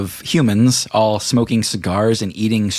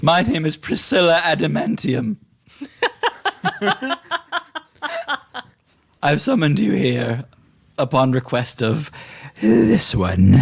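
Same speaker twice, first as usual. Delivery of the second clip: higher, laughing grand introduction; Priscilla the hostess; 9 semitones higher than usual. Acted. Priscilla introducing herself to Snack, high and grand. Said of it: "she says".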